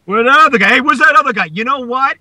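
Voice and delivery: high, loud mock demand; hammed-up heckling; pestering fan act. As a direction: call it out like a nagging fan. Acts fan asking, then answering back.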